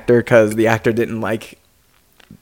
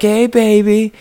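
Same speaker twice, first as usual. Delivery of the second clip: high, teasing Joker voice; crazy Joker; 10 semitones higher than usual. Retold or imitated. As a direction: taunt like a manic clown. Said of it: "dressed as Joker".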